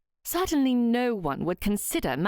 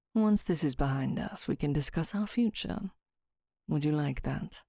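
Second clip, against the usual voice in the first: Southwood, tender; 5 semitones lower, 3 dB quieter than usual. A narrator's reading. Southwood courting Myra, lower and soft. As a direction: lower and softer suitor's voice.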